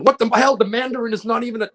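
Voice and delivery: loud, high-pitched cry of fan outrage; outraged uproar. Retold or imitated. Actor mimicking fans' uproar over the Mandarin, loud high outrage. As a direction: re-enact an indignant uproar, voice shooting way up and loud. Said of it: "we're all in an uproar".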